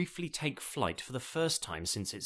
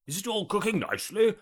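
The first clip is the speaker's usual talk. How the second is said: higher, lightly put-on voice of a self-satisfied cook; pompous inspector; 9 semitones higher, 5 dB louder than usual. Sean checking the oven, playing the expert cook. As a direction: ask it like the expert in charge.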